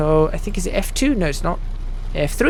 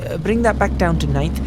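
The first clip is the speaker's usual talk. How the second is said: usual speaking voice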